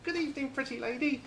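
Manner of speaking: high squawky parrot voice; high-pitched parrot impersonation. Acted. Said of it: "The first thing the parrot says".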